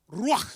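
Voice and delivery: Ruach with a loud, trilled R; rolled R. Shown as a demonstration. Preacher drilling the congregation, loud rolled R. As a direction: roll the R hard in Ruach. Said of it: "roll that R".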